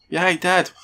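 loud, high-pitched mock exclamation; exaggerated outburst. Telling how they answer a friend, exaggerated outburst.

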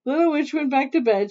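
plain reading voice

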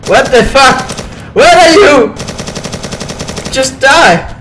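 loud, high frantic yell; panicked shouting. Real, not acted. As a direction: shout at an unseen attacker. Shouting at unseen attacker.

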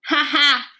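high, loud happy laugh. A narrator's reading. Janitor happy hamster is smiling, laughing. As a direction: laugh with delight.

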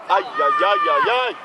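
high, wailing run of ajajaj; dismayed ajajaj. Real, not acted. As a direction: groan at the near miss. Coach reacting to near miss. (wysokie, jękliwe ajajajaj)

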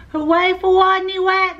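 high crowd-style hooray; cheering cry. A narrator's reading. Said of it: "they cried".

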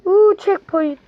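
high, loud delighted "Uuu"; thrilled little whoop; excited checkpoint cheer. Real, not acted.

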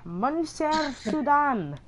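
high, slow mocking name; mocking drawl. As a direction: stretch the name out high to mock it.

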